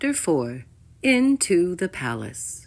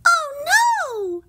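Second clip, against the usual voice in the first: very high, drawn wail; high-pitched princess despair; dismayed groan. A narrator's reading. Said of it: "Poppy groaned".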